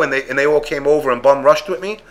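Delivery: ordinary delivery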